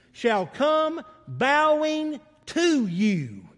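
slow, drawn-out solemn reading; scripture reading. Acted. Preacher reading Isaiah to the church, slow and solemn. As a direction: let each phrase of the verse land slowly. Reads Isaiah verse slowly.

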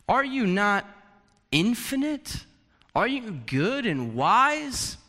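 bewildered insult. Retold or imitated. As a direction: challenge God in disbelief. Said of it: "Think of it like this".

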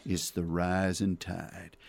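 slow, low Texan drawl, Tommy Lee Jones style. Retold or imitated. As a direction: drag it out low like a weary Texas sheriff. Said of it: "Tommy Lee Jones says".

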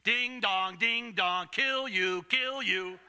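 chiming, evenly tolled words; sing-song echo of a threat; ringing bell. Acted. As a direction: toll each pair like a bell in the head. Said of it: "You think that rang in my ears?".

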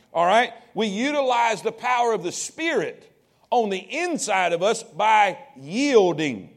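ordinary talk, nothing marked

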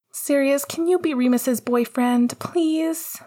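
put-on high, eager child voice; pleading little brother. A narrator's reading. Little brother asks Sirius to be boyfriend, high pleading. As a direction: beg sweetly in a high kid's voice.

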